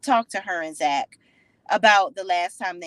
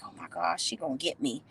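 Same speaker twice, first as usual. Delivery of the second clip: a rattled whisper-soft worry; hushed bewildered fear; 9 dB quieter than usual. Retold or imitated. Belinda realizing she's in trouble, scared murmur.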